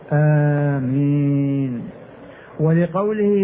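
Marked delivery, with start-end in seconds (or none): 0.1-1.8 آمين with both vowels held very long; drawn-out آمين. Shown as a demonstration. Teacher showing students how the voice is lengthened, drawn-out آمين. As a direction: stretch آمين slowly on a level tone. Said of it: "يمد بها صوته".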